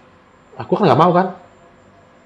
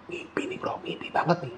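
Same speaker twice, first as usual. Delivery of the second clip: leises tonloses Flüstern zum Freund; whisper; 3 semitones higher, 12 dB quieter than usual. Retold or imitated. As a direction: heimlich zuraunen, ungläubig. Whispering to friend in ritual.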